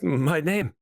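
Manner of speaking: wounded man's lightly scratchy voice; halting, throat-clearing scratchy speech. Acted. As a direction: struggle to get your name out through a scratchy throat. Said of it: "through a sort of scratchy voice".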